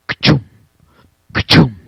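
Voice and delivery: short percussive chop noise; imitated hatchet blows. Player retelling the attack on the door, punchy thud noises. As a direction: mimic a blade thudding into wood. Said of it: "And then I hear".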